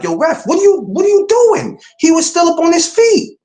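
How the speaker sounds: high outraged protest at the referee; yelling at the ref. Retold or imitated. Fans protesting a stoppage to the referee, high outraged yell. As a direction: shriek it at the ref in disbelief. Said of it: "we like".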